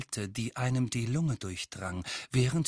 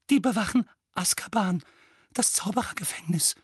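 Aunt Petunia; 9 semitones higher, 3 dB louder than usual. A narrator's reading. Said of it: "sagte Tante Petunia".

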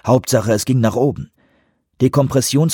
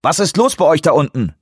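tinny voice over the radio; 6 semitones higher, 3 dB louder than usual. Acted. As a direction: sound like a crackly radio. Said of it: "Die blecherne Stimme des Technikers".

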